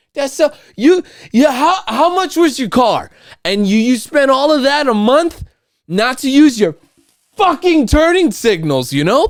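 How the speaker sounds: heated, high-pitched rant at other drivers; angry rant. Real, not acted. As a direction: vent at drivers who skip their turn signals. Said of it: "we're getting a little angry".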